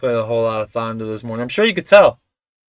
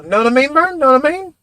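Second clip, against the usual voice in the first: high, eager Ernest catchphrase; hammed-up high Ernest voice; Ernest impression; 11 semitones higher, 4 dB louder than usual. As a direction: ask Vern like Ernest. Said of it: "Do you remember Ernest at all?".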